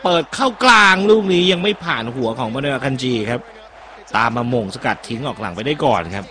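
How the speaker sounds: live play-by-play. Acted. Commentator calling Canada's attack to viewers, lively play-by-play. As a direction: call the move as it happens, rising with the play.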